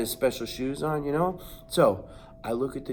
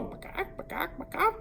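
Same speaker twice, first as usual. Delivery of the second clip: hen clucks; 13 semitones higher, 8 dB quieter than usual. Retold or imitated. Hens clucking in a row.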